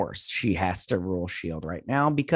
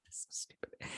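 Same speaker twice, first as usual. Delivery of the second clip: whispered scoff; 22 dB quieter than usual. Real, not acted. Talking to viewers, she mutters a whispered "so stupid". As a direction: whisper "so stupid" under a laugh.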